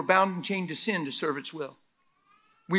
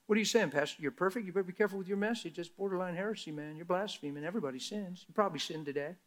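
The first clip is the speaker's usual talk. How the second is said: mock churchgoer; 9 dB quieter, faster than usual. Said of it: "Do you ever hear people talk like this?".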